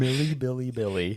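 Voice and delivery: playful high sing-song name. Pretend. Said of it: "most fun way to say her name".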